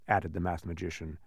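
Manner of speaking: usual speaking voice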